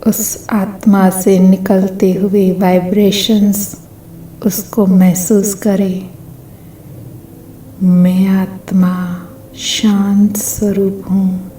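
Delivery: unhurried, evenly pitched meditation voice; guided meditation. Acted. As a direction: guide listeners gently into stillness, phrase by phrase.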